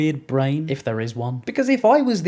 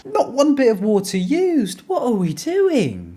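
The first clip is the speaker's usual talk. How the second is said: incredulous; 7 semitones higher, 3 dB louder, slower than usual. Real, not acted. Reacting to dry shaving.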